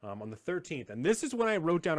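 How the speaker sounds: usual speaking voice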